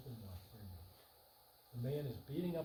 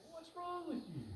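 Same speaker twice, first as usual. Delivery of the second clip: pitched way up, indignant what's-wrong question; incredulous retort. Retold or imitated. Husband snapping back at his wife, high and indignant. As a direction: throw it back at her in disbelief. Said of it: "I say".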